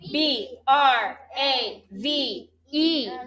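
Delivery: slow, even letter-by-letter spelling; spelled letter by letter. Shown as a demonstration. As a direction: call out each letter slowly for the class to join. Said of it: "let's all spell just the base word".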